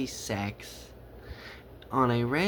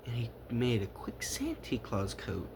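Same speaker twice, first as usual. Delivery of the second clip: ordinary delivery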